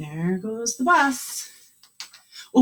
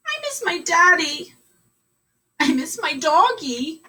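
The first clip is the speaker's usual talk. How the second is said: put-on much higher, small frightened child voices; scared little kids; 8 semitones higher than usual. Kindergarteners on the bus tell the driver they're scared. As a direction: whimper like a homesick child.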